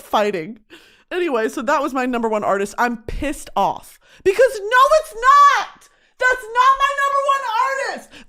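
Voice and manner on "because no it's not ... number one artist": high, loud indignant no; outraged protest. Real, not acted. Outraged that Midland is her number one artist. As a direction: protest it loudly, pitched up.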